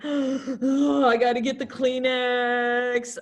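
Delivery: gasp, then a drawn-out tearful wail; mock sobbing. Teacher acting out herself at sad TV movies, mock sobbing. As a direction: wail it like a hammed-up crier. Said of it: "Sobbing. I am".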